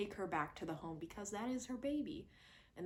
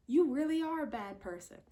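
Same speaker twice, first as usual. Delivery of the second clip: accusing; 7 semitones higher, 5 dB louder than usual. Retold or imitated. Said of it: "And it's like".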